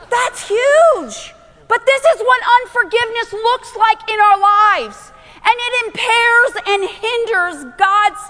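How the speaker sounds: urgent, high-pitched sermon delivery; full-force preaching. Acted. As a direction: drive each phrase home to the congregation.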